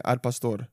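Al Pastor with careful Spanish sounds; respectful Spanish pronunciation. Shown as a demonstration. Ordering food respectfully, Al Pastor in Spanish pronunciation. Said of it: "I'm gonna respect it".

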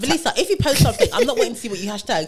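loud laughing rant, high and loud; teasing a friend about liking posts, loud with laughter. Real, not acted. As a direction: laugh through it while teasing her friend.

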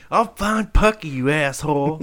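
high, dismissive put-on voice; hammed-up rude brush-off; mock rude stoner. Voicing the rude owner.

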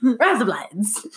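high, slow Aussie attempt; stretched-out mock Australian accent. Shown as a demonstration. Retrying the trick phrase, broad Australian accent. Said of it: "In an Australian accent".

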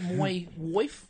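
attempted Irish accent; tentative go at an Irish accent. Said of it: "with an Irish accent".

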